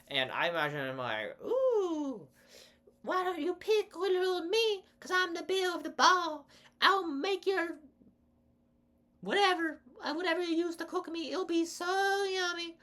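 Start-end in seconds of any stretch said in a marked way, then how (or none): 1.4-12.8 very high, sugary southern belle voice; princess pepper voice. Pretend. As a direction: coo it like a vain little pepper princess. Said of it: "That's what she sounds like".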